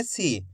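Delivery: सी said short and low; a soft, unstretched सी; short vowel. Shown as a demonstration. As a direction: keep सी brief, don't stretch the vowel. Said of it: "ज़्यादा खींच के नहीं करेंगे".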